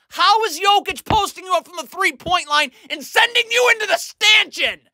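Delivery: exasperated yell. Real, not acted. Film breakdown coach yelling at Adebayo, exasperated shout. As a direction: yell at the player in disbelief.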